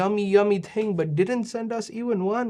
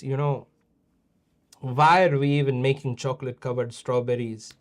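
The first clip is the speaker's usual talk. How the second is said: usual speaking voice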